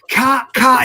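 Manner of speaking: loud, high "Cut, cut" shouted across the set; shouting cut over the set, high and loud; excited director. Retold or imitated. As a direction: call cut like a thrilled director. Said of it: "he goes over the old director".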